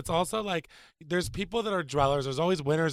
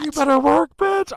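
high, loud sassy diva cheer; diva catchphrase; 10 semitones higher, 9 dB louder than usual. Pretend. Cheering a friend's great credit score, high and loud sassy diva. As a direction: belt it out like a drag queen.

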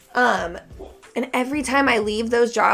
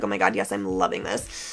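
deep silly voice; 14 semitones lower, 6 dB quieter than usual. Retold or imitated. Retold excited reaction.

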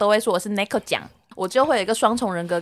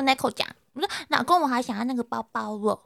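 coquettish; 8 dB quieter than usual. Retold or imitated. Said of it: "我就这样撒娇".

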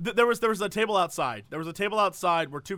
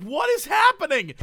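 high, loud, incredulous question; mock bewilderment; 9 semitones higher, 6 dB louder than usual. Baffled diners react to the announcement, loudly incredulous. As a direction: blurt it out in exaggerated confusion.

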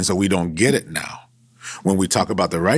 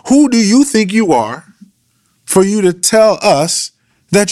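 heated street confrontation, much higher and louder; 10 semitones higher, 5 dB louder than usual. Retold or imitated. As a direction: call Job out like a guy from the block.

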